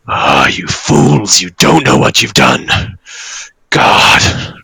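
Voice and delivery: low, slow, breathy villain snarl; snarling dragon. Acted. Wounded dragon berates the fools who released something, snarling. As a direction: snarl it heavily, drawn out and exasperated. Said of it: "he just snarls and he goes".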